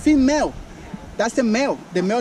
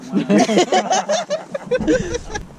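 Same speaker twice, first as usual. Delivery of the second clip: high laugh; 12 semitones higher than usual. Real, not acted. Joke about falling out.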